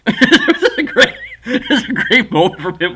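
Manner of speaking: high, loud laughter through the words; laughing. Real, not acted. Teller recalling a great moment from a friend, laughing. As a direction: laugh while you talk. Laughing at his own retold story.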